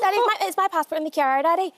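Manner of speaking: put-on Northern Irish Derry accent calling to dad. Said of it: "let's listen to [name], and we'd love to get your Derry Girls".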